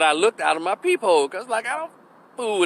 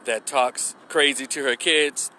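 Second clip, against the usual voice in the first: ordinary delivery, nothing marked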